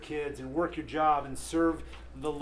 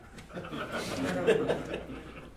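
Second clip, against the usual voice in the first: breathy laugh; 3 semitones higher than usual. Real, not acted. Laughing at his own story.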